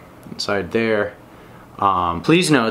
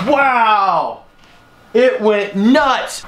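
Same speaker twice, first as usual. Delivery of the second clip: shocked exclamation; 9 semitones higher, 3 dB louder, much slower than usual. Real, not acted. Drone crashes into the fan.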